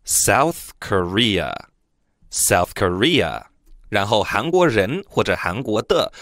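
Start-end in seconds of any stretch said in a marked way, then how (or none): 0.1-3.4 South Korea said slowly and clearly twice; teacher's model word. Shown as a demonstration. Teacher to students, slow careful model. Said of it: "韩国英文叫South Korea".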